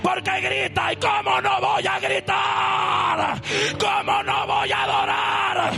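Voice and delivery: preaching at full force. Acted. Pastor proclaiming to the congregation, preaching at full force. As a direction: preach it out to the whole church.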